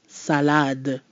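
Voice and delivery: salade with two clear open a's and a crisp final d; French salade. Shown as a demonstration. Teacher modelling salade for students. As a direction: say salade evenly, both a's full. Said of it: "Salade means salad".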